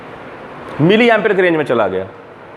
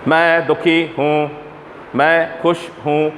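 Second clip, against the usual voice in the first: slow, flat single-tone robot voice; monotone; much slower than usual. Shown as a demonstration. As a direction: say both feelings on one pitch, slowly. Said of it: "क्योंकि ये single tone था, monotone". (एक ही सुर में धीमे, सपाट)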